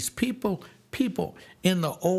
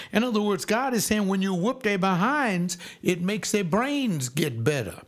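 high, driving preacher's exhortation; preaching; 5 semitones higher than usual. Acted. Preacher urging parents not to hold back, high and driving. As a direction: preach it hard to the congregation.

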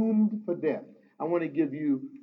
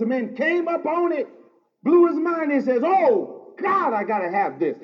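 raised, ringing pulpit voice, building to a shout on the key lines; full-force preaching; 7 semitones higher, 4 dB louder than usual. Acted. Pastor exhorting his congregation, preaching at full force. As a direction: preach it hard and lift the room.

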